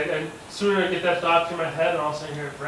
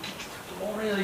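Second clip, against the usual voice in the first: tense whisper; 5 semitones higher, 8 dB quieter than usual. Retold or imitated. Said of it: "I'm like".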